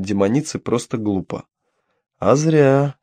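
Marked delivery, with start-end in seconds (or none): 2.2-3.0 higher, with a bared-teeth smile; predatory grin. A narrator's reading. Demoness threatening her prisoner with a toothy grin. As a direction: savour it like a predator. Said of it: "оскалившись, как какая-то хищница".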